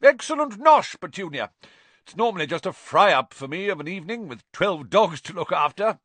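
very high, loud, booming praise; a bluff dog breeder pleased with her dinner; loud, high, self-satisfied aunt; hearty Aunt Marge. A narrator's reading.

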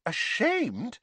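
high, blank echo of the word; blank, uncomprehending girl; blankly. A narrator's reading. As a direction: repeat it as a puzzled question. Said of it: "said Hermione blankly".

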